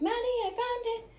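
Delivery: high, triumphant announcement; excited relief. Retold or imitated. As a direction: call it out like good news. Mom finds the tooth.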